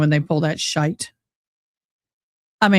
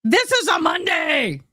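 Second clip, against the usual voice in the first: exasperated outcry; 7 semitones higher, 3 dB quieter than usual. Real, not acted. Exasperated vlogger complaining to viewers.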